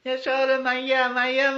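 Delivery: very high, loud cry for mother; child's frightened call. Acted. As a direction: scream for mama like a scared little boy. Frightened child Krishna calls mother.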